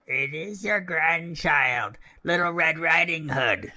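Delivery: wolf imitating a little girl; wolf faking a girl. Wolf at the door, mock little girl voice. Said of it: "speaking like a little girl as well as he could".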